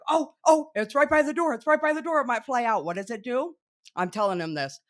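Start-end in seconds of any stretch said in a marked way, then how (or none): 0.1-2.9 high, loud urgent warning; excited alarm. Retold or imitated. Wife retelling her warning to her husband, high and urgent. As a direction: urge him as the bee nears the door. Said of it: "And I'm like".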